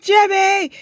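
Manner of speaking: high, very loud panicked shriek; hammed-up cry for help; terrified scream. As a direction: scream the name as if the plane is dropping. Retold grabbing boss as plane drops.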